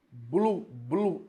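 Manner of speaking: "Blu" split slowly, B and L both clear; "blu" articulated syllable by syllable; sounding out blu. Shown as a demonstration. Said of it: "Percebam que sai o som do B e do L".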